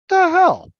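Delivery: high, loud "the hell?" in shock; stunned disbelief. Retold or imitated. Reading the Laundry List, shocked loud disbelief. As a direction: react as if the list floored you.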